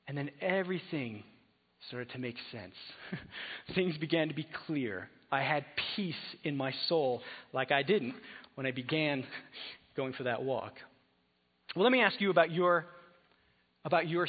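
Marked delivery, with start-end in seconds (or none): none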